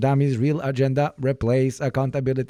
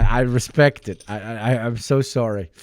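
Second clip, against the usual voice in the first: normal talking voice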